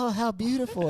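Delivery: lightly voiced awestruck crowd; admiring onlooker. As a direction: coo it like a gawking bystander. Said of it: "Everyone's like".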